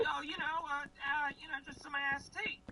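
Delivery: high sweet Southern granny voice; old Southern lady accent. Retold or imitated. Faking a call to Grandma on the phone, high Southern granny voice. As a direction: chirp it up high like a folksy grandma. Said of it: "how you would say it with that accent".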